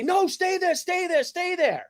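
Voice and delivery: high-pitched imitation of a cop's order; retold urgent order. Cop ordering the kid on the scooter to stay put, urgent. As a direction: draw out the repeated command, pitched high. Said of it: "I just hear him say".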